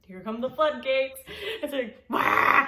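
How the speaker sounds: loud, slow, hammed-up wail; loud comic imitation of bawling; mock bawling. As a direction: belt out a comic wail of tears. Telling how she burst into tears.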